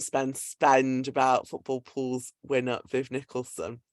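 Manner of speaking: ordinary talk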